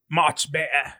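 put-on British with dropped sounds; British accent attempt. Shown as a demonstration. Host shows how she sounds, British accent attempt. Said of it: "I sound like".

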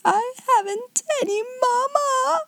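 put-on very high child's wail; half-hearted howl. A narrator's reading. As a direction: wail like a small child, not full strength. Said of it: "made her first howl rather half-hearted".

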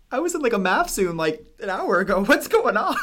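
high, loud, laughing panic; incredulous laughing outburst; laughing disbelief. Retold or imitated. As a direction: blurt it out through a laugh. Panicked quote after cancer news.

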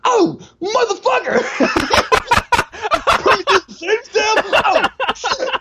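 very high, slow shrieking cartoon voice; exaggerated cartoon intonation. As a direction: squeal it like a cartoon kid. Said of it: "talks like a fucking cartoon character".

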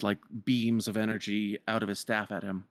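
normal talking voice

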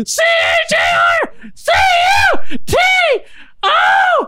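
high, slow shouted letters; shouting in pain. Real, not acted. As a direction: yell the letters out one by one. Spelling while cheeks pinched.

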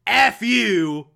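a hammed-up furious phone rant; retold angry yell. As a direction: bark it down the phone in mock fury. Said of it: "gets pissed off and yells at him".

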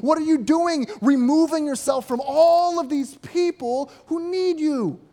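loud, high, exasperated questioning; incredulous disciples. Retold or imitated. Simon and companions scolding Jesus for slipping away. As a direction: badger him like frantic friends. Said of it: "they exclaimed".

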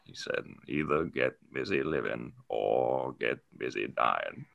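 low, soft, gravelly narrator voice; Morgan Freeman. Acted. Doing the Shawshank narrator for the hosts, low and slow. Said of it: "let me try and do the quotes".

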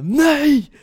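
very high, loud cry; desperate shriek. Retold or imitated. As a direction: refuse with a shriek. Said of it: "Og hun bare".

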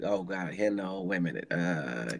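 fumbling for a name, stalling; groping aloud for the word; brain freeze. Real, not acted. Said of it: "I got a brain freeze".

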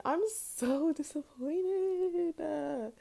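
slow, high, laughing through disappointment; laughing despair. Real, not acted. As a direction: laugh helplessly at the letdown. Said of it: "I'm just disappointed".

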